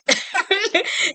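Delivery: bursts into a high real laugh; amused laugh at the movie quote. Real, not acted.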